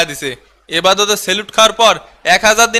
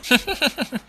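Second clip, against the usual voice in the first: high giggle; 6 semitones higher, 8 dB quieter than usual. Acted. Laughs at insult joke.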